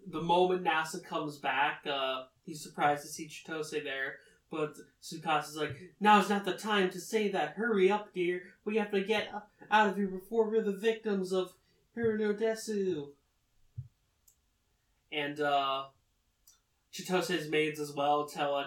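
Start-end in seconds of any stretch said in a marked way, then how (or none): 6.0-13.1 higher, louder hurry-up order; pressing, play-acted urgency; urgent warning. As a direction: hustle someone out in mock alarm. Retold urgent order to flee.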